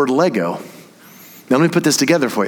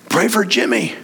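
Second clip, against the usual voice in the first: worried mother; 10 semitones higher than usual. Retold or imitated. Mothers messaging the preacher.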